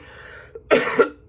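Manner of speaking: short, loud unvoiced cough; real cough. Real, not acted. Reader excuses themselves to listeners while sick, coughing. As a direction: cough hard, clearing a sick chest. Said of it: "while I cough".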